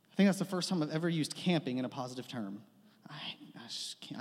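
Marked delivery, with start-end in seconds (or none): 3.1-4.2 soft, breathy aside; whispered half to the crowd; real whisper. Real, not acted. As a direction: whisper it to yourself. Aside about camping.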